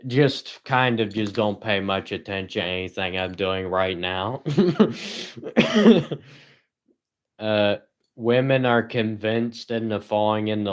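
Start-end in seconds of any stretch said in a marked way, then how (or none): 4.5-6.2 high giggle. Real, not acted. Laughing at his own remark.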